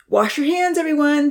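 high, loud, eager call; piping up. A narrator's reading. Zahir reminding everyone before dinner, piped up loudly. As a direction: pipe up bright and loud. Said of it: "Zahir piped up".

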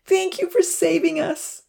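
put-on high, adoring girlish thanks; grateful. A narrator's reading. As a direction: thank your rescuers with high-pitched adoration. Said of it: "Rosa said gratefully".

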